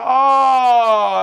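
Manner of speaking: loud, high, drawn-out retold oh; sudden realisation. Prisoner hearing Dan's name as it all clicked, realising. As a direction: let the penny drop loudly.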